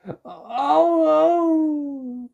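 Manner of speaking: very high, loud gasping cry. Acted. Dentist inside the fox's mouth, high gasping cry. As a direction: gasp in alarm. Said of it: "he gasped".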